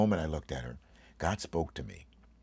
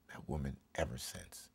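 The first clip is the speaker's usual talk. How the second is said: normal talking voice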